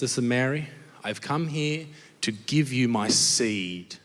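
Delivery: low, slowing grand proposition; solemn seducer. Acted. God announcing his purpose to Mary, low mock-solemn delivery.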